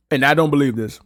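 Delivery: usual speaking voice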